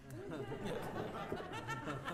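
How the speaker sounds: high, breathy giggle; a high giggle on set. Retold or imitated. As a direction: let a silly giggle escape. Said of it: "like, laughed".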